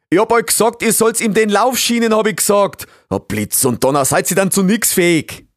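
loud, high exasperated rant; angry scolding. Acted. Beaver master berates assistants over the unsplinted leg, angry shouting. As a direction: bawl them out in dialect.

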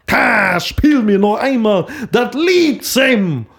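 slow, booming fake film delivery; Werner Hansch voice. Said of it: "nochmal so Werner Hansch in Casablanca".

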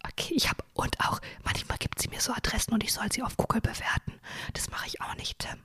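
hushed whisper, barely voiced; whispered confession. Real, not acted. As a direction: whisper it like a guilty secret. Confessing ignored dental advice.

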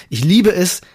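normal talking voice

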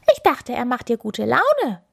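high-pitched little hare. A narrator's reading. Hare explains to the bear, small high voice. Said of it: "sagte der Hase".

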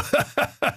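airy mocking laugh; breathy mocking giggle. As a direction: giggle at him. Sewer worker laughs at Peter. (spöttisches Kichern)

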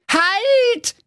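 very high, loud desperate shout; desperate cry. Acted. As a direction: yell to stop him hanging up. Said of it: "rief verzweifelt". (verzweifelter, schriller Ruf)

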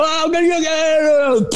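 high-pitched mock gasp of recognition; startled fan. Imitating a startled fan on the slope, high mock gasp. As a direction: squeak it out like a fan spotting a star. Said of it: "die mich zum Teil auch erkannten und dann auch noch sagen".

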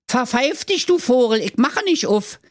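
high, defiant piglet voice; mocking piglet call. A narrator's reading. Lila taunts the wolf from inside, mocking call. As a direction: call it back high and cheeky. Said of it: "lachte nur und rief".

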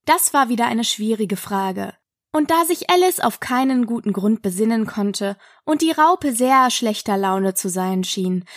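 plain reading voice

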